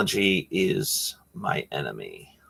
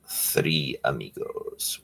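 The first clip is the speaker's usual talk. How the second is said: low drawn-out echo; 7 semitones lower, 9 dB quieter, much slower than usual. Shown as a demonstration. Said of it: "one of my favorite movies called".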